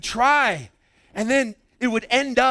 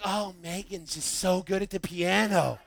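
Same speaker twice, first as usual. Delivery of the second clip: softer, gushing mom voice; doting praise; 4 semitones lower, 8 dB quieter than usual. Retold or imitated. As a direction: coo over the star student. Mom praising star student.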